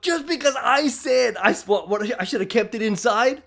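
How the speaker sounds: ordinary talk, nothing marked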